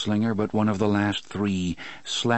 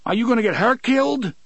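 much higher and louder, fast, furious; angry demand; 7 dB louder, faster than usual. Acted. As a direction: shout it in his face, desperate. Eddie grabs shirt, demands.

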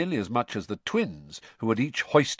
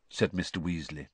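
ordinary delivery, nothing marked

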